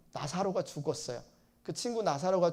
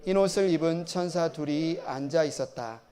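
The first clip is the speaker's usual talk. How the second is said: steady, measured Bible reading to the congregation; scripture reading; 5 dB louder than usual. Acted. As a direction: read the Gospel aloud with solemn care. Reads Gospel passage aloud before sermon.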